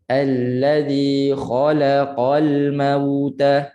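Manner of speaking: very slow recitation of "Allazi khalaqal mauta", la given its full beat; even beats. Shown as a demonstration. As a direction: recite "Allazi khalaqal mauta" slowly, each short vowel equally timed. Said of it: "Biar adil satu harakat". ("Allazi khalaqal mauta" perlahan, adil satu harakat)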